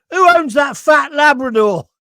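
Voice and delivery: loud, high call across the room; indignant shout. Retold or imitated. Customer bursting into a pub at lunchtime, shouting indignantly. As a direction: demand an answer from the whole pub.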